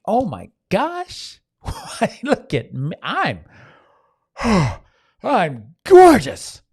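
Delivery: high, drawn-out swooning over your own reflection; mock vain preening; vain self-admiration. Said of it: "they look at that face ... and they can think".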